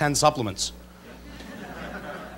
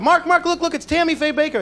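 high excited calling out, imitating dad; a father thrilled to spot a star; 10 semitones higher than usual. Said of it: "he gets all excited".

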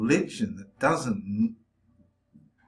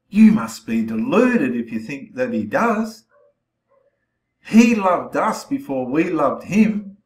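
louder, hammering each word home; forceful preaching; 7 dB louder than usual. Real, not acted. Preacher scolding viewers, loud and forceful. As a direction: preach it like a rebuke, slowing on the last line.

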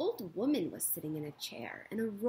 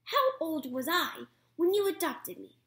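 put-on high, loud, doubtful young voice; suspicious gosling; 7 semitones higher, 6 dB louder than usual. Gosling demands proof from the robot mother. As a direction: test her with each question.

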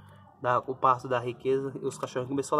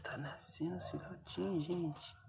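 whisper; 4 semitones higher, 10 dB quieter than usual. Real, not acted. Whispering as puppies arrive.

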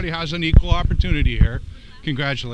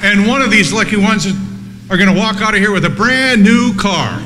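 booming prize announcer; 4 semitones higher than usual. Acted. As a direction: sell the prize like a TV host. Game-show prize announcement bit.

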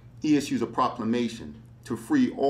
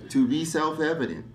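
lofty recitation; 6 semitones higher than usual. Acted. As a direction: proclaim it like a founding document. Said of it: "very lofty verses".

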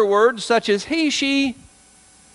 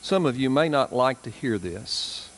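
solemn preaching; 7 semitones lower, 7 dB quieter than usual. Real, not acted. Preacher warning hardened hearts, soft and low. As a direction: speak gravely and gently, heavy pauses.

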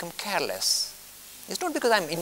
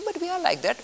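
much higher, a put-on objecting listener; mock indignant protest; 13 semitones higher than usual. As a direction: pipe up as the offended listener. Listener protests being truthful.

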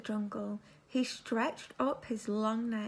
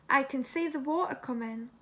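usual speaking voice

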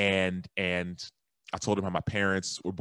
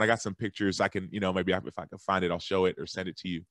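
ordinary talk, nothing marked

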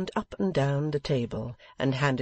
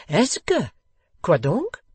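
much higher, louder French question; French accent; 6 semitones higher, 7 dB louder, slower than usual. A narrator's reading. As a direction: ask eagerly in French.

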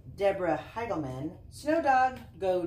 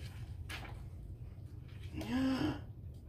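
dramatic gasp; 6 semitones higher, 5 dB quieter than usual. Acted. Lost dog searching for family.

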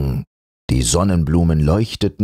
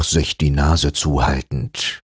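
ordinary delivery

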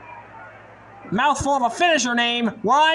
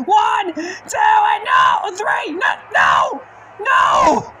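frantic shout; 8 semitones higher, 4 dB louder, much slower than usual. Acted. Near-fall, count broken.

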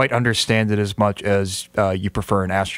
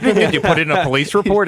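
mock indignant question, pitched up; higher-pitched, mildly patronizing quizzing; condescending; 7 semitones higher, 4 dB louder than usual. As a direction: imitate a dad who knows better, talking down. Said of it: "talking down to him about it".

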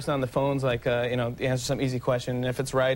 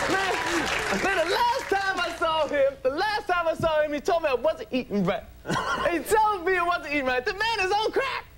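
very high, strident comedian voice; hammed-up high-pitched comic impression; Chris Rock. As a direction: push it high and punchy, stand-up style. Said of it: "have to talk like Chris Rock".

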